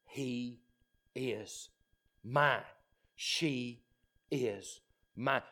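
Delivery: soft, slow and intimate; tender. Acted. Jesus claiming his own before the Father, tender. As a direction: say it softly like a treasured promise.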